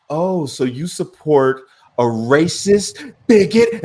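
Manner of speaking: hammed-up outrage, dragging each word; lady at the store turning red, mock outraged.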